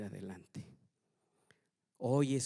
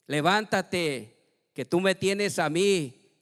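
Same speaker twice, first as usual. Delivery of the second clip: God's commanding call; 6 semitones higher, 11 dB louder than usual. Retold or imitated. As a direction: call him to rise up with power. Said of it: "Dios ... me dice".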